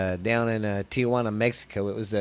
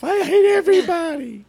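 high, loud huffy whine; petulant outburst; 7 dB louder than usual. Retold or imitated. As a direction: throw a sulky tantrum. Mock grumpy exit line.

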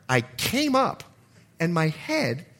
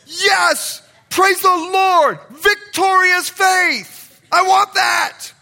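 shouted much higher and louder, stretched out slow; mock crowd cheer; 10 dB louder, much slower than usual. Preacher mimicking the congregation's reaction, a loud, slow, jubilant shout. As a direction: bellow it like an overexcited congregation member. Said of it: "everyone hears that story".

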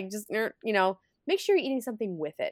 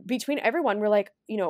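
normal talking voice

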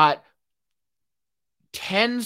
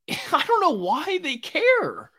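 high, slow, laughing disbelief; 13 semitones higher, slower than usual. Real, not acted. Vlogger laughing in disbelief at archaeologists. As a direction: laugh it out, baffled why anyone cares.

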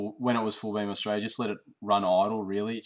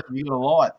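Australian demo; 4 semitones higher, 8 dB louder, faster than usual. Shown as a demonstration. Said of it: "That's what he should say".

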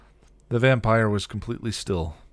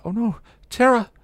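high, loud alarm; horrified shout to a loved one; panicked cry; 9 dB louder than usual. A narrator's reading. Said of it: "panicked as she shook".

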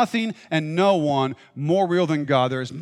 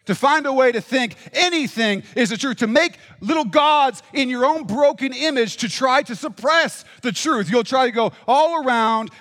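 rising preacher's fervor, higher; preaching; 6 semitones higher than usual. Acted. Preacher pressing the crowd in a fervent, lifted voice. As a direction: drive the congregation upward.

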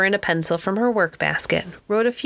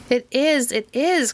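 despairing cry; 6 semitones higher, slower than usual. A narrator's reading. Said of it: "cried Missus Montague in a despairing voice".

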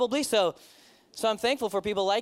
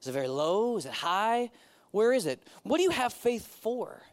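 ordinary talk

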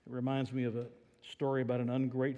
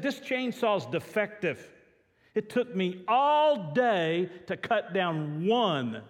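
grumbling complainer; 6 semitones higher, 4 dB louder, slower than usual. Retold or imitated. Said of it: "He's angry. He's fussing".

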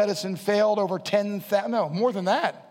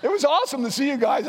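laughing; 7 semitones higher than usual. Real, not acted. Laughing about ninja students.

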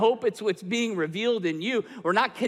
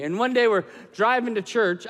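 ordinary delivery

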